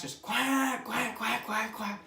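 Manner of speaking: high nasal quacks; duck quack imitation. Shown as a demonstration. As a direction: quack like a hen mallard. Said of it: "As loud as you can".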